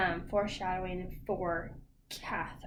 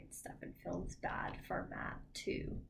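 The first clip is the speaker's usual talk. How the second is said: whispering; 2 semitones lower, 8 dB quieter than usual. Real, not acted. Tired late-night talk.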